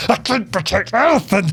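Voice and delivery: over-the-top mock hysteria; mock mental rant. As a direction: imitate a man completely losing it. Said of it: "going absolutely mental".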